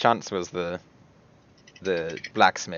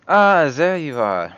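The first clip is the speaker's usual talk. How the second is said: drawn-out, raised, louder greeting; Hiada's greeting; 7 semitones higher, 7 dB louder, slower than usual. Acted. Hiada greets the captain in church, drawn-out raised greeting. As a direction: greet a comrade in character, voice lifted. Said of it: "And she goes".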